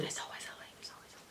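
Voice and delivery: ghostly breathy whisper of the motto; spooky whisper. Pretend. Students told there's always a link, whispered eerily.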